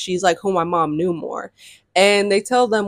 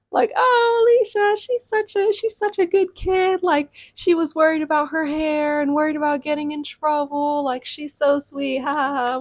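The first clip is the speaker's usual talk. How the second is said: a sweet, over-the-top lady cooing; gushing mom; 7 semitones higher than usual. Retold or imitated. Troop leader gushing to mom.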